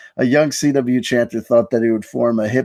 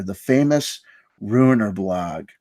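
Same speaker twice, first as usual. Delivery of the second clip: ordinary talk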